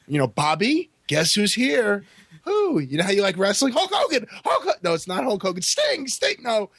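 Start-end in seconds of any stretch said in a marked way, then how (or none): 0.3-2.0 lightly voiced parent announcing a surprise; raised, sing-out surprise for a child; excited parent. Parent surprising a sick child, excited. 2.4-6.7 put-on high, eager cries of a kid guessing; hammed-up high-pitched excitement; mock excited guessing. Dying kid guessing which wrestler is here, mock excited.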